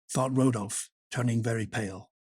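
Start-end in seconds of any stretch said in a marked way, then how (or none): none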